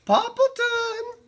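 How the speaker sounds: a bright high neighbourly call across the yard; calling out as Cherry Sue. A narrator's reading. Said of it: "kept calling".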